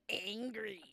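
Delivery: lightly strangled, drawn-out growl of a word; slow mock strangling. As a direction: stretch it out like you're choking yourself. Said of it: "Strangling myself".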